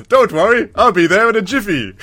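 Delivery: high, loud mock hero voice; mock chirpy hero. Captured agent reassures his caller, high hammed-up hero voice. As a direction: sing out the reassurance, pitched well up. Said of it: "And then Ethan goes".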